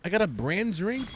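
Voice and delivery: surprised. Real, not acted. Surprised at game reward.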